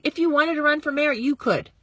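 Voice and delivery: ordinary delivery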